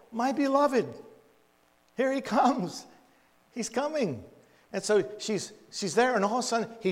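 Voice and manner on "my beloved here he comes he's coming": high, loud, eager calling out; excited call. Acted. Bride spotting her beloved from the window, calling out high and loud. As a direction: call out to him from the window, thrilled. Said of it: "she yells out".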